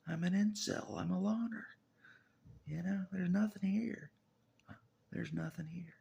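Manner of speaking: self-mocking outburst. Real, not acted. To the camera about being alone, a high loud self-mocking wail. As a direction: wail it out with a laugh.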